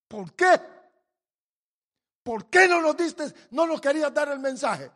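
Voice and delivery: loud, high demanding why; preacher's loud rebuke to the prophet; shouted rebuke. Acted. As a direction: shout it at Jonah, demanding an answer. Preacher voicing God rebuking Jonah.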